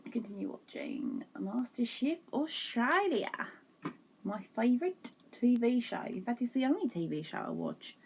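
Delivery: mock Australian accent. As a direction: echo the Australian TV voice, half-joking. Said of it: "I apologise for my terrible accent".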